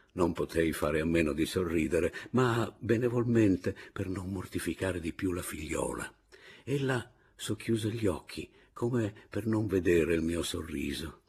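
ordinary delivery

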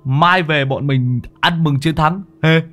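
high, loud and thrilled, calling his mate; bursting with glee at the catch; overjoyed shout. Acted. Muôn Phọt calling Đồng to hold the lamp, high excited shout.

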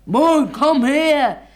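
loud searching call with long drawn words. Retold or imitated. Actor calling out for Mo during the play, loud and drawn out. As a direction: shout across the stage for Mo.

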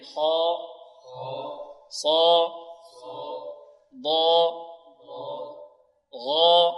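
each of خاء، صا، ضا، غا، طا، قا، ظا held long with a full emphatic "aw"-coloured a; heavy letters. Shown as a demonstration. Teacher drills class on elevated letters, heavy and sustained. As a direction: chant each heavy letter, lips unrounded, pausing between. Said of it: "بدون ضم الشفتين".

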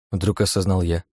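usual speaking voice

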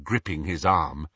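normal talking voice

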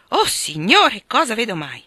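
put-on scandalised old aunt exclaiming. A narrator's reading. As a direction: gasp out at the sight. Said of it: "esclamò la vecchia".

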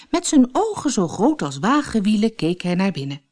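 ordinary delivery

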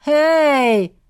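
sharp loud call down the corridor; shouted hey. Acted. Teen shouts at strangers in the hallway, loud. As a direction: bark it out to stop them.

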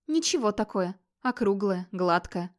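usual speaking voice

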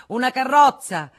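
high, loud shout from afar; shouted call. A narrator's reading. Federico calling to the waiter from afar, shouted order. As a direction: shout it out to someone far away. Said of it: "gridò da lontano al cameriere".

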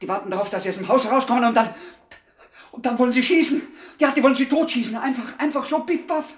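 frantic warning. Acted. Henchman blurts out that gunmen wait outside, rising alarm. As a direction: blurt out the ambush plan in a panic.

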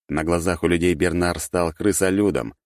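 ordinary delivery, nothing marked

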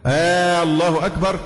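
first hamza of الله stretched long into آلله; long opening madd. Shown as a demonstration. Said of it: "المد ال-، ال-، الجامد في ال-، في ال-، في الألف في الأول". (مد همزة «الله» في الأول)

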